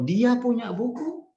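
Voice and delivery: Dia punya buku high, climbing on buku; question intonation. Shown as a demonstration. Teacher gives another example, high questioning intonation. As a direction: ask it with a big upward lift on buku. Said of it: "Intonasinya kira-kira seperti itu".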